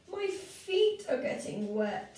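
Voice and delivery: high, slow whiny complaint; complaining crumpet man. Acted. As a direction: whine about wet feet.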